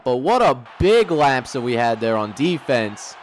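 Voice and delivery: ordinary talk, nothing marked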